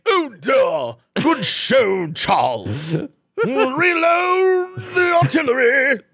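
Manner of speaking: hammed-up upper-class British accent, rising high at the end; mock posh British. Cohost imitating British soldiers in a posh British accent. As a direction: play a plummy British tank officer. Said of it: "British dudes firing from the tank".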